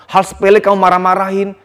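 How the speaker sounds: usual speaking voice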